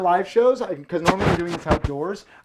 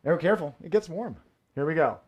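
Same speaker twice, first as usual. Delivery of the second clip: usual speaking voice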